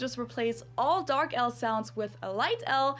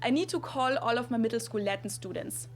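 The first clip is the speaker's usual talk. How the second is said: whole sentence in a German accent; German-accented English with light L; full German accent. Shown as a demonstration. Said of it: "do the whole accent on the sentence".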